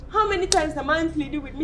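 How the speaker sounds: angry accusation. Acted. Accusing her sister in a quarrel.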